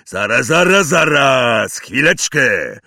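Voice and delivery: loud, furious repeated wait; angry shout. Acted. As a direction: shout to stop everything. Furious Hagrid.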